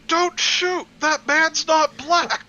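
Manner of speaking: high, loud cartoon cop yell; hammed-up panicked cop shouting; mock yell. Friend quoting a cartoon, hammed-up high yell.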